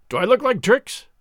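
captain crying out. Acted. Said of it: "cries the captain".

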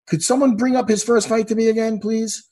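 normal talking voice

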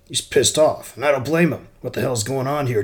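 angry. Acted. Lou angry at boss.

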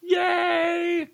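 high, drawn-out delighted yay; excited cheer. Real, not acted. Co-host celebrates her friend's new obsession, excited cheer.

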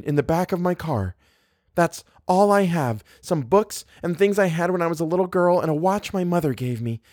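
put-on high, childlike pleading. A narrator's reading. Guest explaining she has nowhere to go, pleading.

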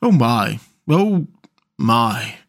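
miserable little moan. A narrator's reading. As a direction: lament haltingly, with pauses. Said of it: "said the mole miserably".

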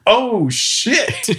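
high, alarmed cry rising at the end; alarmed oh shit. Retold or imitated. Seeing knives in the brawl, an alarmed high cry. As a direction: yelp it as the knives appear. Men come out with butcher's knives.

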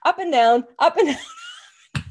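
climbing up and down, breaking into a high laugh; rising laugh on the last down. Real, not acted. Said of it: "like, a spiral staircase".

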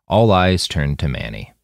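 usual speaking voice

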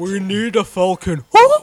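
mock studio boss. Voicing Disney demanding merch.

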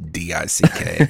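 D I C K spelled out letter by letter. Real, not acted. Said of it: "I can spell it".

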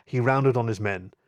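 plain reading voice

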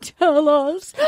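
slow, high mock pleading; overwrought mom. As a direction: beg like a hammed-up anxious mother. Mom begs son for news.

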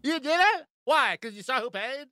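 put-on very high, squealed drunk questions; imitating a whiny drunk; mock drunk rant. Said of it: "really drunk Brandy keeps yelling".

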